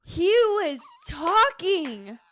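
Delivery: loud, slow, laughing gasp; laughing shock. Real, not acted. Friend hearing the kissing gossip, loud and laughing. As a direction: drag it out through laughter.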